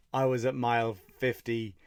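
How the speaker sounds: normal talking voice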